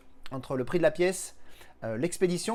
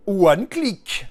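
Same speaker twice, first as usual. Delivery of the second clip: booming announcement; 3 semitones higher, 9 dB louder than usual. Pretend. Announcing the product name.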